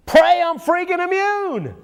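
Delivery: spring the answer with glee; triumphant punchline. Real, not acted. Punchline announcing his only hope.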